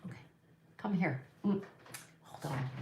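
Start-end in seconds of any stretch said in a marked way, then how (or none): none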